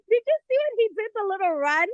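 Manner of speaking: laughing. Real, not acted. Laughing at his little run.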